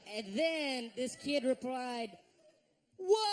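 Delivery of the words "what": loud, high mock disbelief; hammed-up incredulous shout; shocked outburst. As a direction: yell it in outraged astonishment. Kid's shocked reply acted out.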